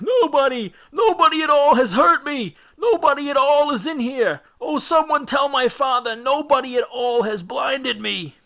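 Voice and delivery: screaming blinded Cyclops. A narrator's reading. Blinded Polyphemus crying out to the other Cyclopses in agony. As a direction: howl in pain to the neighbours. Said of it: "The cry came back".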